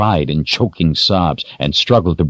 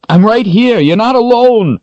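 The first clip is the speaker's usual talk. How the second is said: urgent reassuring man; 5 dB louder than usual. Acted. Richard voiced, urgent.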